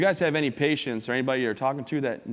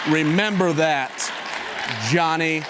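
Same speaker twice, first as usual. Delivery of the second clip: mock slow warning; 5 semitones higher, 5 dB louder, much slower than usual. Dad's final warning to Johnny.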